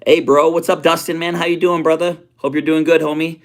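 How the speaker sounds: high, loud hype greeting; excited greeting. Real, not acted. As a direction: shout out a new arrival. Hyped greeting to new viewer.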